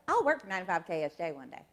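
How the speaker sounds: quick Alabama twang, words run together; Southern accent. Retold or imitated. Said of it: "when the accent comes out".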